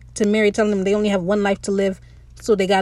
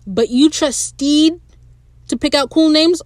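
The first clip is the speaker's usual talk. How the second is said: incredulous; 5 semitones higher, 3 dB louder than usual. Real, not acted. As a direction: question it like it's absurd. Disbelief at the character's choice.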